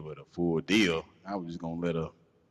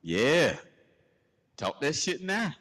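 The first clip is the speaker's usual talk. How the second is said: taunting; 8 semitones higher than usual. Retold or imitated. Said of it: "he's talking about some".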